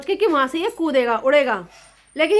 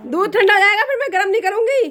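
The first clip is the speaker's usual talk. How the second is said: higher and louder, half laughing warning; loud teasing threat; 7 semitones higher, 5 dB louder than usual. Real, not acted. Daughter-in-law warning her sleepy mother-in-law, loud teasing. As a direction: warn her loudly about the milk.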